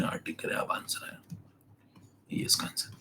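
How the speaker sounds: whispered aside to himself; a soft whispered aside while writing the answer. Real, not acted. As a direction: breathe the answer under your breath. Muttered answer at board.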